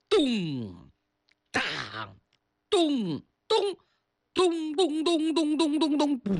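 imitating the war drum beat by voice; drum booms. Shown as a demonstration. Teller imitating the thunderous war drums, booming. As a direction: boom out each drum stroke. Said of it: "比我学的声音大得多得多".

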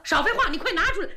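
fast, loud and impatient; impatient order. Acted. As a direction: hurry him up. Princess hurrying the guard.

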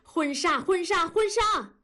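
stubborn wife shouting her demand again and again; angry shouting. A narrator's reading. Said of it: "文丽气得大声地说".

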